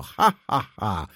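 wysokie, gorzkie "ha, ha"; drwiący śmiech zranionej damy; szyderczy śmiech. Acted. Scornful laugh. (zagrany szyderczy śmiech)

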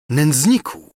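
indignant outburst; outraged cry. Acted. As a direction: spit the word at him. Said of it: "zawołała księżna".

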